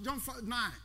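normal talking voice

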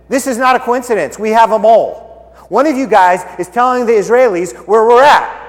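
a king sniffing out a traitor; suspicious king. Acted. Enemy king accusing his men.

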